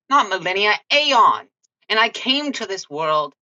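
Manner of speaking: bristling with offended pride; indignant Grace. Acted. Grace indignantly answering the wizard who called her an animal.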